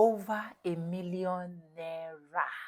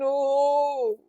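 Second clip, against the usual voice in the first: retold scream; 6 semitones higher, 10 dB louder than usual. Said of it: "I was still screaming".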